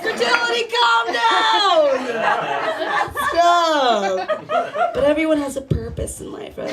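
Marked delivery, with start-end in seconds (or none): 0.0-4.4 high shouted plea at your own body; mock shout. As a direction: yell it high like begging fertility to stop. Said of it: "You're like".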